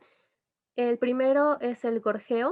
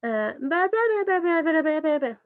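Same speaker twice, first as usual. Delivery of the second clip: high, loud ba ba babbling; playful baby jargon; baby babble; 6 semitones higher, 4 dB louder than usual. Shown as a demonstration. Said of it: "Simplemente es como".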